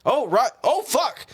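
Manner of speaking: high-pitched acted dismay; hammed-up "just remembered" yelp; mock sudden realization. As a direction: act out remembering too late. Acting out forgotten midday game.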